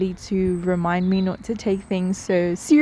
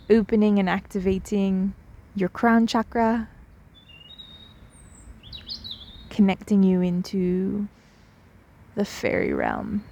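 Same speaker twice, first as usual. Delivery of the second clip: guided meditation. Real, not acted. Guide sprinkling fairy dust on the listener's crown, meditation voice. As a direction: let each phrase settle into a long pause.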